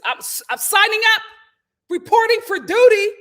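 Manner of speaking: hammed-up soldier; mock soldier. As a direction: play an eager recruit. Mock enlisting.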